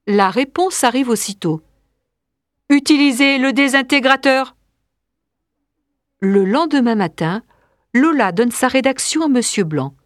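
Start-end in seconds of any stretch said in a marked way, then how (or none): 2.7-4.5 monotone machine command; robotic computer. Acted. Big Brother answers the android, robotic monotone. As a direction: give the order on one unvarying note.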